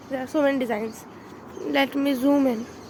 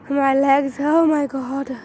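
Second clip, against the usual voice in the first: freezing cold; 6 dB louder, slower than usual. Real, not acted. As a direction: voice tight from the cold. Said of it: "it's all freezed".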